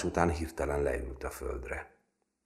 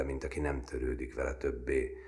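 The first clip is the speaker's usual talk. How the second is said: ordinary delivery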